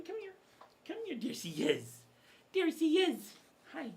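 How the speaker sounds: high, sing-song pet voice; high cooing dog talk. Real, not acted. Talking baby talk to his dog.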